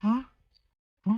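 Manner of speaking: ordinary delivery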